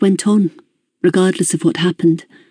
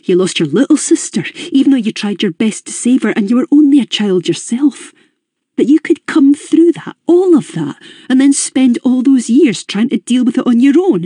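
furious; 7 semitones higher than usual. Acted. Raymond furious at what Eleanor went through, building anger. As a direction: let his anger climb on every phrase. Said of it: "he said, finally, furious".

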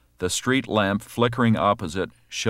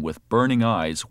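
usual speaking voice

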